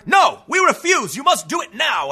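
exaggerated shouted ultimatum; mock defiant shout. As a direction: imitate protesters shouting a demand. Acted protesters refusing, demanding action.

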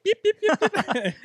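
high squeaky yapping, like a toy puppy; toy dog yips. Retold or imitated. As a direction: yap it out like a little electronic dog. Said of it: "the little yip".

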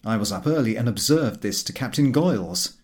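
ordinary delivery, nothing marked

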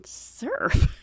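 baffled questioning "Surf"; puzzled echo. Retold or imitated. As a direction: echo "Surf" back in disbelief. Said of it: "And I was thinking".

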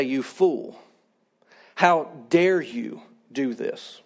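low, slow, quiet scolding; mock berating. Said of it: "go over to my son and berate him".

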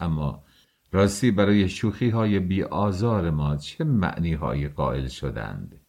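ordinary delivery

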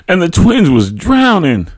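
high, loud anxious exclamation; exclaiming. A narrator's reading. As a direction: blurt out the dream's horror. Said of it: "my mother exclaimed".

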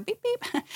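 high squeaky beep beep; horn beep. Pretend. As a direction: chirp it like a tiny horn. Mock horn sound.

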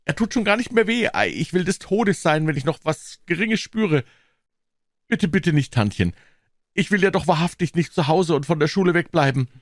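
put-on very high, lively boy's begging; suddenly wide-awake, high protest; hurried pleading. A narrator's reading. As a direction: beg quickly to be spared. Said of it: "Eiligst rief Tom".

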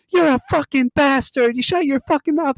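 high-pitched furious comeback; angry retort. Retold or imitated. Housemate Jamaica cursing back at Dick: high, angry retort. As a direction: snap back at him, voice jumping up high. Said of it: "And she's like".

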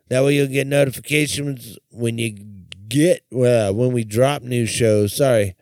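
a bit stoned and scattered. Real, not acted. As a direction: talk like you're high and losing the thread. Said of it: "really high right now".

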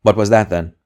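loud, sharp question; sharp demand. A narrator's reading. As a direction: demand it at once. Interrogator demanding.

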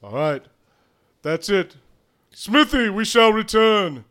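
loud high declaration; grand announcement. Acted. Character announcing.

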